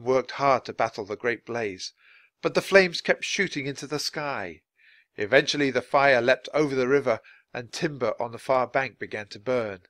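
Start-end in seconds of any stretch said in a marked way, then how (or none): none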